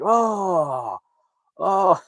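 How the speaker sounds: high, drawn-out "oh"; mock grossed-out groan. Narrator mimics everyone watching her puke, mock groan. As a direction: groan like a disgusted crowd. Acts out the disgusted onlookers.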